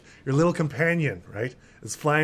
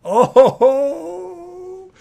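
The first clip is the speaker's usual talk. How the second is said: much higher, loud surprised cry; delighted oh; 9 dB louder than usual. Real, not acted. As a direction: gasp out a thrilled oh. GM reacts to the player's move.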